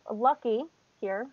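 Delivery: usual speaking voice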